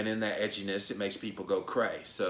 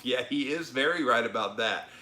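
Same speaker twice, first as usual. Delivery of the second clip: usual speaking voice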